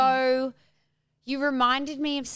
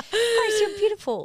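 laughing; 11 semitones higher, 5 dB louder than usual. Real, not acted. Hyped compliment with laughter.

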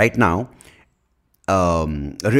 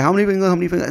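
pushy optometrist; 12 semitones higher, 4 dB louder, much faster than usual. Retold or imitated. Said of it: "She's like".

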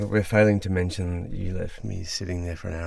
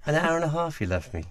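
sing-song Irish lilt, pitched higher; mock-indignant Irish priest voice; Irish lilt, higher than usual; Irish accent; 8 semitones higher than usual. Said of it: "Keep going with your Irish accent".

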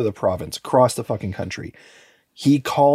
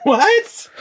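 high squeaked outraged what; shocked disbelief; 6 dB louder than usual. Real, not acted. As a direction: yelp it in disbelief. Shocked reaction to the case.

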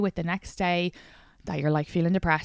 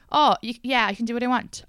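mocking; 6 semitones higher, 5 dB louder than usual. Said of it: "Not everybody".

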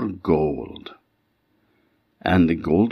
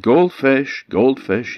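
calling out; 9 semitones higher, 4 dB louder, slower than usual. Acted. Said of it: "he cried".

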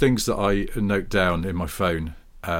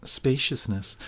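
a light Southern Californian drawl on Spaciousness, softer; Southern Californian accent; 4 semitones higher, 5 dB quieter, slower than usual. Shown as a demonstration. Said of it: "saying spaciousness in a Southern Californian accent".

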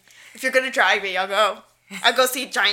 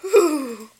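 loud, higher whew call; playful whew; 5 semitones higher, 7 dB louder than usual. Pretend. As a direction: answer the Mufasa bit with a big exhale. Mufasa bit.

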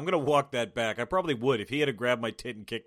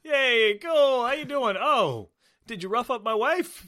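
high, chirpy mock greeting to an old pal; squeaky fake-cheerful hello; mock buddy greeting; 11 semitones higher, 5 dB louder than usual. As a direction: ham up the overly friendly buddy.

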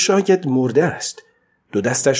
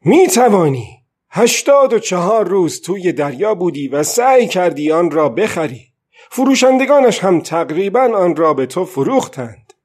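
put-on old man answering his own question, higher and louder; firm answer to himself; 6 semitones higher, 3 dB louder than usual. A narrator's reading. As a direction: answer your own doubt with stubborn conviction. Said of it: "پیرمرد گفت".

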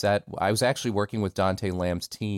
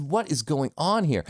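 retold bafflement; 8 semitones higher than usual. Retells his bafflement on set.

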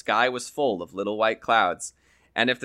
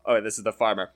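plain reading voice

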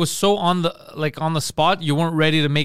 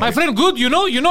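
higher, chummy mock Greek accent; put-on Greek accent; 6 semitones higher than usual. Friend switching accents for the shopkeeper, mock Greek accent. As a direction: do a friendly Greek customer, laughing. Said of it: "they'll just change".

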